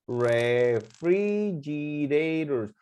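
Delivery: "refrigerators" very slowly broken into syllables. Shown as a demonstration. Teacher counting the syllables for students, very slowly segmented. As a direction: draw out each syllable of "refrigerators". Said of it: "Son tres sílabas".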